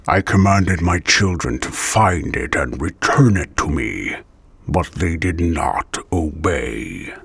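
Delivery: low, slow, hard villain read. Shown as a demonstration. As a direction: lay it down cold and commanding. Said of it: "Start off with the hard, with a hard voice".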